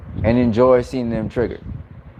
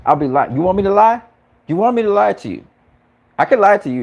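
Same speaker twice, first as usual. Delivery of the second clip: higher and louder challenge; heated insistence; 9 semitones higher, 5 dB louder than usual. Real, not acted. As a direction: press the point hotly. Arguing with chat over apology.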